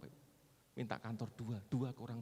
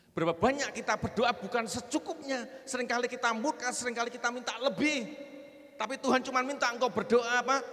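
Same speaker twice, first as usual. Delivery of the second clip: loud, raised preacher's proclamation; full-force preaching; 13 semitones higher, 10 dB louder than usual. Real, not acted. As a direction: drive each promise home loudly. (khotbah lantang berapi-api)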